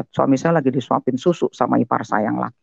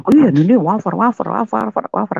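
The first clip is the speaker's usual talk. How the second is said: higher-pitched, rattled-off list; retold excited discovery; 9 semitones higher, 3 dB louder than usual. Mother and child discovering many wafers, retold excitement. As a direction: pile up the word like an amazed finder.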